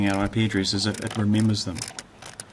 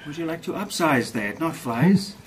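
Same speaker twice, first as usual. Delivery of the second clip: lightly voiced cashier, higher pitch; a hint of a counter clerk upselling; 6 semitones higher, 3 dB louder than usual. Fast food counter joke.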